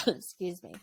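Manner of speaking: ordinary talk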